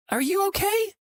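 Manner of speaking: very high, small female voice; small woman's voice. A narrator's reading. Woman checking on the trucker who yelled, small high voice. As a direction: ask it in a tiny high-pitched voice.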